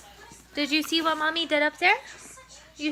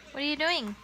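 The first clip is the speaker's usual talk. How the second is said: normal talking voice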